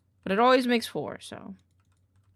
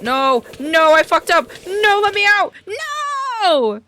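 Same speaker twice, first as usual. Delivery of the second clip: loud high panicked screams; panicked screaming; 11 semitones higher, 15 dB louder than usual. Real, not acted. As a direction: scream to be let out.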